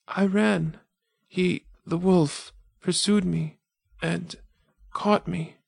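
put-on high, slow girl's voice fading with each phrase; a wounded girl telling it between breaths; weakening dying girl. Dying girl to the wanderer, high and weakening.

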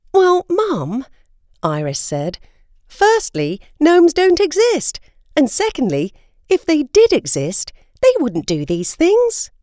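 a hint of a sensible daughter; young Iris. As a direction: daughter reasoning with her mother. Iris argues with mother.

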